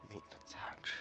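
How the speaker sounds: soft unvoiced whisper. Real, not acted. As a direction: whisper it under your breath. Muttering plan to party.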